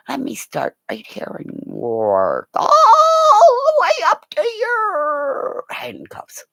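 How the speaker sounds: high, slow, drawn-out vowels; playful frisk request. Retold or imitated. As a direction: stretch it out teasingly, sliding up high. Acting her flirty request to police.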